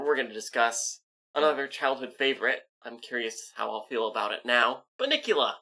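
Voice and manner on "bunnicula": high, bright title call; excited announcement. Real, not acted. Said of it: "You gotta say it in a spooky voice".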